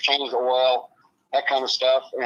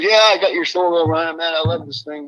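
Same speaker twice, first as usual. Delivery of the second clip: louder, higher quote of a thrilled friend; retold excitement; 4 semitones higher, 7 dB louder than usual. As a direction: imitate a friend raving over a borrowed snowmobile. Retold happy phone call from Dylan.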